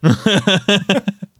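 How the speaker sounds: bright high-pitched laugh. Real, not acted. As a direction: burst out laughing. Laughs at the whispered hint.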